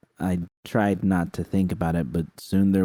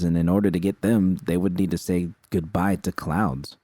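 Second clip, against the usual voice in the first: usual speaking voice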